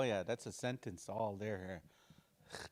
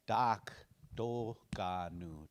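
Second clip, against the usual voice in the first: slow each syllable of Do Ga Nu; 3 semitones lower, much slower than usual. Shown as a demonstration. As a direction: Do Ga Nu spelled out slowly, G clearly voiced. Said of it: "And a G right here".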